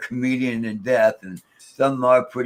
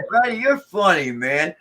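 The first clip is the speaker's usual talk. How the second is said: much higher, louder amused reply; retold laughing reply; 7 semitones higher, 5 dB louder than usual. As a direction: tease the spirit while laughing. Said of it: "I started laughing".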